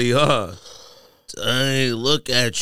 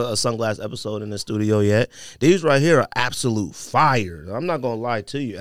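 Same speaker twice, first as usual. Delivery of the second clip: ordinary talk, nothing marked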